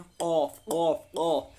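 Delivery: "काफ" repeated with a thick, heavy qaf. Shown as a demonstration. Teacher drills the thick letter, "काफ" said heavy. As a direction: make each "काफ" full and heavy. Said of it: "इसको मोटा पढ़ते हैं".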